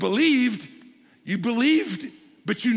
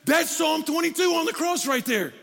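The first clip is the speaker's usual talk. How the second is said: excited realization; 6 semitones higher than usual. Retold or imitated. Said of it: "and it's like".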